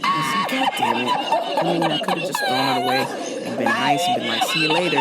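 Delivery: talking through real laughing fits; helpless laughter. Real, not acted. Storyteller recapping the smashed pump, helpless with laughter. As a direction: keep telling it while breaking up. Recaps rage while cracking up.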